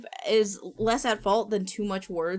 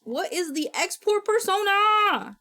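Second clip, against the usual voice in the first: exasperated; 10 semitones higher, 7 dB louder than usual. Real, not acted. Exasperated loud question.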